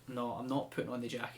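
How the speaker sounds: usual speaking voice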